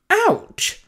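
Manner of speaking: very high, falling exclamation; high-pitched stung retort; mock-hurt growl. A narrator's reading. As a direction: react as if stung. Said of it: "Albedo growled".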